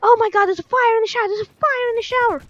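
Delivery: high alarmed cry; panicked voices. Retold or imitated. Woken classmates panicking about the fire, high alarmed cry. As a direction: sound freaked out by the news. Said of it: "it was like".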